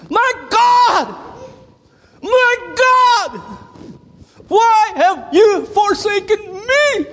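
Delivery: high, loud, slow cry of anguish. Acted. Preacher voices Jesus on the cross, crying out in anguish. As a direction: wail it out in agony. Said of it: "here's how I think it was".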